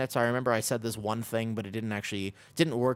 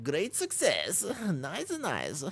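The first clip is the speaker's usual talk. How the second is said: slow, high mock Borat accent with a laugh; a quick playful Borat accent impression; 7 semitones higher, much slower than usual. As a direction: ham up a Borat accent, pleased with yourself. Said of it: "I didn't do the Borat accent".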